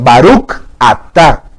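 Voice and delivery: Baruch atá said slowly, keeping Baruch and atá clearly apart. Shown as a demonstration. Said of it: "El sefardí marca mucha distinción".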